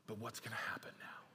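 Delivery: hushed suspense whisper. Real, not acted. Preacher whispering to the congregation in suspense. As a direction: lean in and whisper the question.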